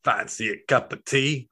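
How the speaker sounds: hammed-up Cockney British accent. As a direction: put on a comic British accent. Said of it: "you're amazing British accents".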